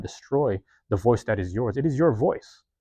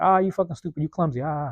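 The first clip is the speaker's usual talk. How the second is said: mocking laughing jeer; 6 semitones higher than usual. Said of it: "they're like".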